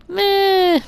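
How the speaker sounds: high squeaky shrug of a meh; dismissive meh. Real, not acted. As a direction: toss it off like it barely matters. Dismissive verdict on own sniping.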